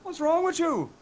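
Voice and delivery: high, loud put-on Irish voice; mock-indignant Irish-sounding prisoner; accidental Irish accent. Prisoner scolds his cellmate, high Irish-sounding indignation. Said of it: "Why is he Irish?".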